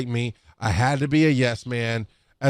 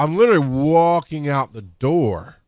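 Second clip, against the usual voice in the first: ordinary talk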